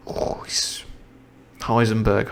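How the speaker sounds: plain reading voice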